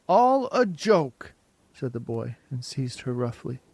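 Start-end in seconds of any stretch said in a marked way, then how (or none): 0.1-1.3 slow, loud, high sneer; drawn-out sneer. A narrator's reading. Boy sneering at Margot before seizing her. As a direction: stretch out the mockery.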